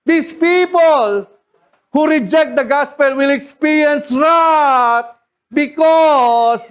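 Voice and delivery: slow, loud, high preacher shout; preaching shout. Acted. Preacher warning the congregation, slow and shouting. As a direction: hammer each word at them.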